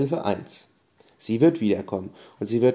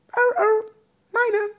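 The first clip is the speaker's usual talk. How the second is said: tiny, very high squeak; squeaky Robby voice; 4 dB louder, much slower than usual. Acted. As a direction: squeak like a small stuffed animal. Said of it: "macht Robby". (hohe, piepsige Stimme)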